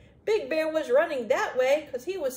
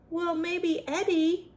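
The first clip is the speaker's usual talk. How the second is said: plain reading voice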